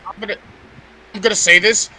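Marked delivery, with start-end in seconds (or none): none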